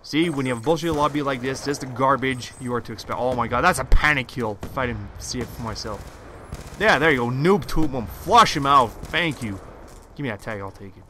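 usual speaking voice

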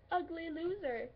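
mock taunt. Quoting the imagined sign over her head, mock jeering. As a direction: call it out like a taunt. Voices imagined neon sign's insult.

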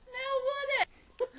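squeals the question way up high; a loud high-pitched mock squeak; high squeaky repeat.

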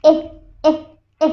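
"এ" as a very short "eh"; short vowel demo. Shown as a demonstration. Teacher demonstrating the symbol's sound, "এ" as a short separate "eh". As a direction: isolate each "এ", cut short with no glide. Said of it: "এই যে সিম্বলটা এটা produce করে".

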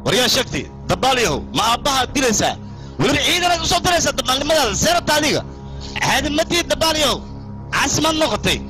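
angry scolding. Acted. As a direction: berate him sharply, voice pitched high. Son scolded for attacking father.

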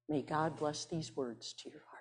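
soft, unvoiced blessing; whispered blessing. Real, not acted. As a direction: breathe a blessing over them. Closing blessing after reading.

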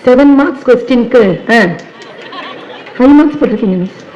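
a pupil's half-laughing whine about marks; complaining student. Retold or imitated. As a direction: play the top student protesting lost marks. (மதிப்பெண் குறைந்ததற்கு ஆசிரியையிடம் சிணுங்கும் மாணவி)

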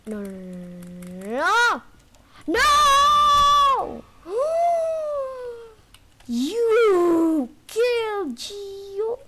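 slow "no"s rising to a very high loud howl, then a drawn-out cry; anguished shout. Real, not acted. Gamer watching granny kill Gio, screaming in despair. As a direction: wail in disbelief at the loss. Character killed in game.

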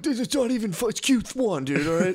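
high, whiny mock bill collector voice; mock creditor. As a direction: nag like a peeved debt collector.

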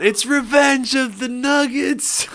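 high, slow mock movie-title yell; hammed-up dramatic yell; mock scream. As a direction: bellow it like a movie trailer. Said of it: "Imagine if he screamed out".

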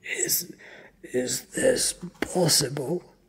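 high, halting disbelief; shocked. A narrator's reading. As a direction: stammer it out in shock. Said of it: "He is in shock".

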